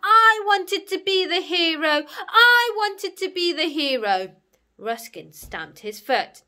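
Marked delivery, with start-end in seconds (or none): 0.0-4.3 high, full-force wailing scream. Acted. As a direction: scream it out like a thwarted child. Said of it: "and screaming".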